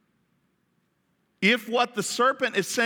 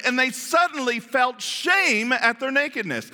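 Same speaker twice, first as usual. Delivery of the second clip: normal talking voice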